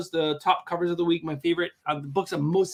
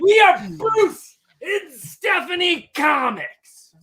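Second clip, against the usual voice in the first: slow booming hype-man shout; wrestling promo; 9 semitones higher, 7 dB louder, much slower than usual. Acted. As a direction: stretch it out like a ring announcer. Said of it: "Bruce is the hype man".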